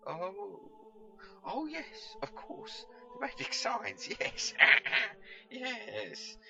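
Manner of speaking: high, fluttery placating voice with a nervous laugh; humouring nervous chap. Acted. Pickle humours the strange old man, high and nervous. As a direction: humour the madman, nervously eager. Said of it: "He obviously needed humouring".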